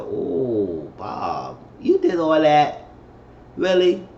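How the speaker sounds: rising, incredulous "really?"; mock disbelief. Reacting to Bob firing Darius, mock disbelief. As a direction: act shocked at what Bob did. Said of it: "I said".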